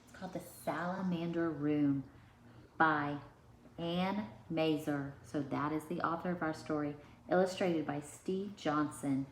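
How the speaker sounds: ordinary delivery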